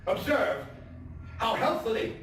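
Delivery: a nervous, wound-up killer confessing in a high, intense voice; tense madman narrator. Acted. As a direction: play the madman insisting he is sane, swinging between hushed and frantic.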